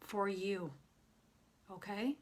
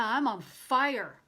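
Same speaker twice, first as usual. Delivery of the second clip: ordinary talk, nothing marked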